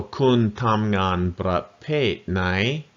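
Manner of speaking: slow, every word of "kun tam ngan bra pet nai" spelled out; slow teaching demo. Shown as a demonstration. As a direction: model the phrase slowly for learners. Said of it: "One more time".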